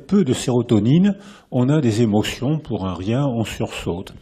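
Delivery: normal talking voice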